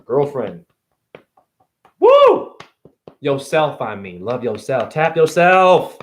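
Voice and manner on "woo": high, loud whoop; sudden ecstatic holler; excited woo. Real, not acted. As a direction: let out a big happy whoop. Excited shout.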